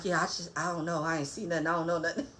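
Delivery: quiet, scared mumbling; scared mumble. Retold or imitated. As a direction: mumble it, nervous and small. Scared man quoted.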